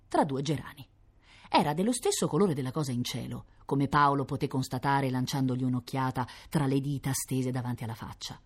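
plain reading voice

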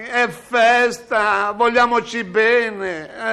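calm him down with exaggerated sweetness; mock soothing. Cameraman soothing the angry director, slow and mock-sweet.

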